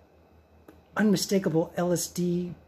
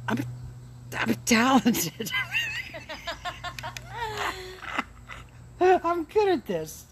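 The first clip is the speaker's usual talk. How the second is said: higher, breaking into real laughter; laughing boast; 12 semitones higher, slower than usual. Real, not acted. Tripping vlogger admits self-praise to viewers, laughing. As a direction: boast while cracking up at yourself.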